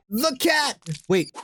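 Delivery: high excited cry. Real, not acted. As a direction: shout it out in delight. Excited outburst at the cat.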